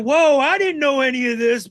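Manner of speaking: hammed-up startled wrestler; mock surprise. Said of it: "And he's like".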